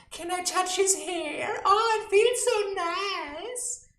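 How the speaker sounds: high, slow, swooning voice; mock gushing stranger. Strangers asking to touch his hair, high cooing. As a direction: coo over it like a creepy admirer. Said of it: "they would ask my parents".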